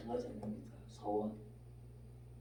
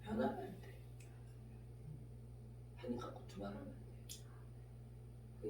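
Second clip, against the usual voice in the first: hushed warning; 12 semitones lower, 18 dB quieter than usual. Acted. Soft emphatic warning.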